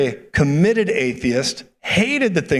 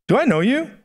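high, puzzled imitation of a stranger; mock wary question, pitch raised; acted bewilderment; 9 semitones higher than usual. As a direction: ask it high, as if baffled. Bewildered drive-thru worker, acted out.

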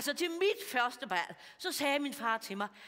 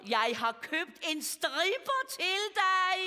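high, slow, building reveal; excited announcement; 8 semitones higher, 3 dB louder, slower than usual. Acted. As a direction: announce the present with mock fanfare. Acted gleeful gift announcement.

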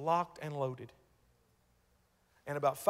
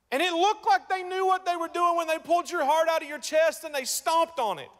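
much higher, louder impassioned cry; preaching peak; 12 semitones higher, 7 dB louder than usual. Acted. Preacher cries out to the congregation at his peak. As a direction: hit the climax of the sermon.

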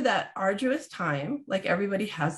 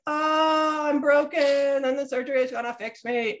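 high, louder hammed-up self-pity; mock despair; 6 semitones higher, 3 dB louder than usual. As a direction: wail it as a mock victim. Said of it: "don't enter it like".

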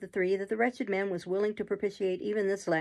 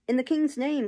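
raised, higher-pitched official demand; crowd's cry; 6 semitones higher, 4 dB louder than usual. A narrator's reading. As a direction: call out from outside the house. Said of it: "cried the voices without".

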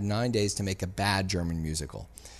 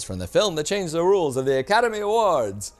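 much higher, louder, booming promo read; trailer announcer; 9 semitones higher, 8 dB louder than usual. Acted. Reading the DVD box blurb, trailer announcer. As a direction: sell it like a movie trailer.

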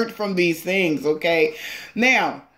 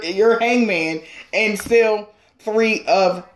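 usual speaking voice

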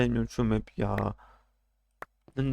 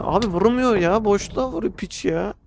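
higher and louder furious complaint; angry yell; 6 semitones higher, 4 dB louder than usual. Real, not acted. Player yelling at the screen about an unfair hit, angry and loud. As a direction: shout your outrage at the cheap hit. Cursing the missing opponent.

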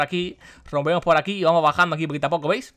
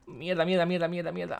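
cursing under breath; 7 dB quieter than usual. Real, not acted. Stuck underwater, muttering curses.

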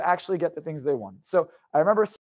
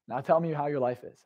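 softer, lower, composed question; calm restart; 4 semitones lower, 7 dB quieter than usual. Retold or imitated. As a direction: drop back down to calm and quiet. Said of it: "Now, let's start over".

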